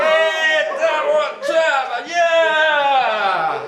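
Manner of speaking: yeah hurlé, aigu et fort; célèbre comme un gagnant fou; cri de joie. Acted. Celebrating the car win. (jubile en criant)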